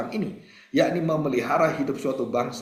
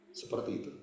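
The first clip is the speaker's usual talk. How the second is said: whisper; 14 dB quieter than usual. Acted. Rhetorical aside trailing off.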